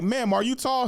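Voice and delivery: high, comic interrogation; calling out. Retold or imitated. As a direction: pitch it up as if quizzing someone across the room. Retells calling out to the woman.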